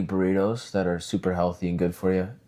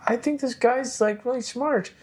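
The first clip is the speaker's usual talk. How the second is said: naive admiration. Retold or imitated. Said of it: "you're like".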